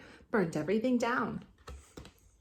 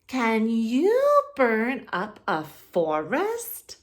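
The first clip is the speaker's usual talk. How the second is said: louder, slow, drawn-out dare; slow challenging question; 4 semitones higher, 7 dB louder, much slower than usual. Acted. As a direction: tease the dragon into showing off. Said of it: "said Elizabeth".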